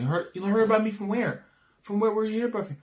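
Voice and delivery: high, curious mock girl voice; girl's reply. Guy playing the girl in his pickup story, high mock girl voice. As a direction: voice her suspicious comeback up high. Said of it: "I feel like I heard about you".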